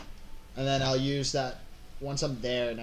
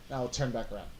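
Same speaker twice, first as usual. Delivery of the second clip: usual speaking voice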